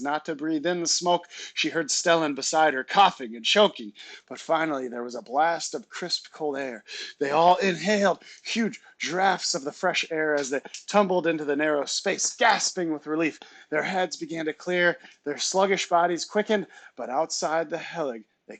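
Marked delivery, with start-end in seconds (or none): none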